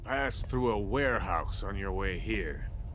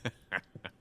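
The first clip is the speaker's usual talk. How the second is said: bitter laugh; 3 dB quieter than usual. Acted. Bitter drunken laugh after betrayal.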